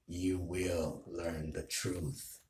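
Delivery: slow, soft villain promise; villain role play. Acted. Villain ending his threat to Tanjiro, slow and ominous. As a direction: drag it out ominously. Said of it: "that's role play".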